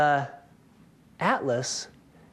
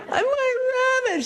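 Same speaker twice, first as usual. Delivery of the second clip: much higher, louder, half-crying wail; imitating wailing neighbor; 3 dB louder than usual. Neighbor finds her dead rabbit on the porch, mock sobbing wail.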